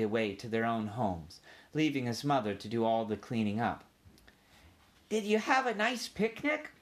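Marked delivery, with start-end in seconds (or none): 5.1-6.7 high, small mouse voice; polite little mouse making small talk; young mouse. A narrator's reading. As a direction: ask timidly, pitched way up. Mouse asks with dread, high voice.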